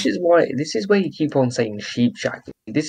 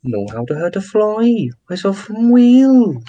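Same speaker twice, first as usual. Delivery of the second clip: high, sing-song parody of Welsh; mock Welsh accent; 6 semitones higher, 4 dB louder than usual. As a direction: tease with a rising, stretched Welsh lilt. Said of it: "I mean, they're all like".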